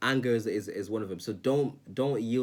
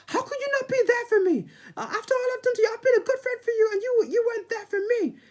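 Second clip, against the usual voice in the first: very high squeaky falsetto whine; a shrill cartoonish needy friend complaining; mock whiny friend; 6 dB louder than usual. Imitating a needy friend complaining about a missed call, high falsetto whine. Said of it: "And they're like".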